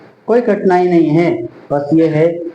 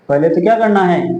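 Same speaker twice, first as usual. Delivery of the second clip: ordinary talk, nothing marked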